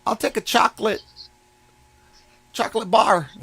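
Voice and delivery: lightly voiced customer lady. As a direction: play the indecisive lady ordering. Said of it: "So this lady goes".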